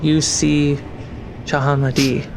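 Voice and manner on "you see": ordinary delivery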